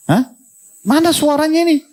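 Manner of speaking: high, playful call to the audience; teasing call out. Real, not acted. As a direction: wake the crowd up with a high teasing question. Preacher prodding silent audience. (seruan menggoda yang tinggi)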